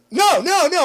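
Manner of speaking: loud, high-pitched mock panic; acted frantic protest; panicked denial. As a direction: blurt it like a kid caught out. Acting his panicked denial to dad.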